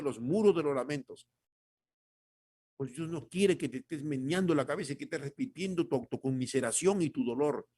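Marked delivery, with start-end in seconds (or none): none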